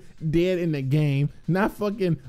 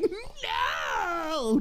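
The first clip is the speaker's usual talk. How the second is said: mock shout no; 8 semitones higher, 6 dB quieter than usual. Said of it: "you would probably just be like".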